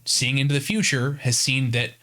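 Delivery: ordinary delivery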